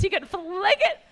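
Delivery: high, loud words laughed through; can't hold back the laughter while bragging; laughing. Real, not acted. Laughing at husband's disc golf.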